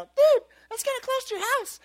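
high-pitched mock kid voice; worried kid. Kid warning his buddy about the prank, worried high voice. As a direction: play a nine-year-old objecting in a squeaky pitch. Nine-year-old self voiced in story.

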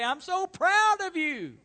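high, loud, drawn-out mock praise; imagined gushing praise. Preacher voicing the praise Peter hoped for from Jesus, gushing. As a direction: gush it loudly, stretching the words. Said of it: "He couldn't wait on Jesus to say".

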